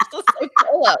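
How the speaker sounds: high, giggly delivery; laughing. Real, not acted. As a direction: talk through real laughter. Laughing at the call glitch.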